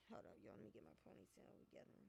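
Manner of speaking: barely voiced, breathy whisper off to the side; whispered aside. Real, not acted. Whispering to viewers while fixing her ponytail, muttered aside. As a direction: drop to a near-silent whisper mid-rant.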